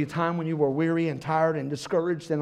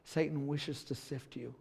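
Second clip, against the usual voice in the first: quiet gentle warning; 3 semitones lower, 10 dB quieter than usual. Retold or imitated. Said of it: "And Jesus had to say".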